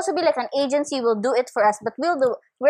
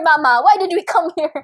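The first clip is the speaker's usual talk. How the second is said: put-on louder, higher complaining child; whiny kid asking mom; 4 semitones higher, 5 dB louder than usual. Retelling asking mother on trip.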